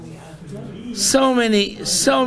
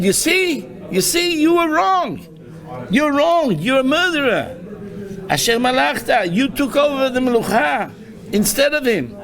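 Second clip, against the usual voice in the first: much higher, scornful jeering accusation; Shimei's mocking accusation; 5 semitones higher than usual. Shimei jeering at the fleeing King David, high scornful taunting. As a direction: taunt him with his guilt. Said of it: "imagine Gdol Hador saying on David".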